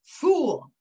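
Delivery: sharp high loud shout of "Fool"; angry nobleman yelling at the praying man; shouted insult. A narrator's reading. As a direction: bark "Fool" as an angry prince. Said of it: "the prince shouted".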